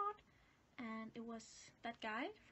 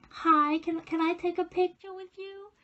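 nervous excited request; 5 semitones higher, 16 dB louder than usual. Retold or imitated. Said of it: "I was like".

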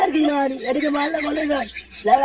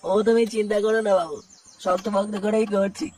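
put-on much lower, humble old workman's voice; old mistri; 8 semitones lower than usual. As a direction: answer the boss like a deferential old labourer. Mistri reassures the club boss. (নিচু গলায় বিনীত বুড়ো মিস্ত্রি)

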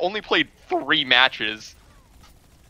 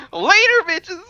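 very high squealed farewell; gleeful taunt; 13 semitones higher, 4 dB louder than usual. Pretend. Player leaving enemies behind, gleeful high squeal. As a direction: squeal it as you get away.

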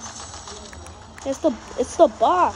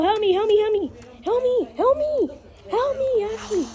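high pleading calls; 10 semitones higher, 4 dB louder than usual. Real, not acted. Teammate must save him.

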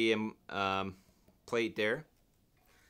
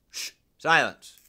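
mock shush; 3 semitones higher, 8 dB louder, slower than usual.

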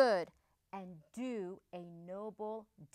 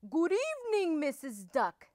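polite greeting; 7 semitones higher than usual. A narrator's reading. Big Bad Wolf bowing to the duck on her doorstep, polite. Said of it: "bowing politely".

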